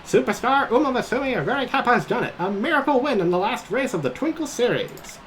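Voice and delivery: high thrilled commentator; excited race call. Acted.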